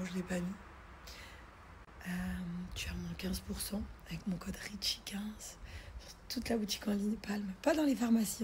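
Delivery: soft breathy whisper throughout. Real, not acted. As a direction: whisper it close to the mic. Whispered voiceover lines.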